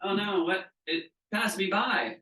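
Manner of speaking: mock dismay at a missed wave. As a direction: play the surfer who missed the wave, aghast and slow. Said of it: "I'm like".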